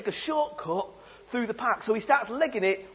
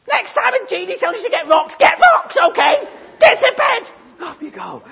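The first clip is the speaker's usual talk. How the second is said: much higher, loud shrieking mum; scolding mum; 10 semitones higher, 11 dB louder than usual. Acted. Mum yelling at Jimmy coming home late, shrill and loud. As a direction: tear into him like a furious mother.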